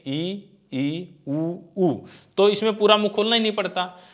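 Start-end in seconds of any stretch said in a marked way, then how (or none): 0.1-2.1 ई and ऊ held with mouth nearly closed; vowel demo. Shown as a demonstration. Teacher showing students the closed vowels ई and ऊ. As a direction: sound ई ई then ऊ ऊ with a narrow mouth. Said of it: "उच्चारण करके देखें".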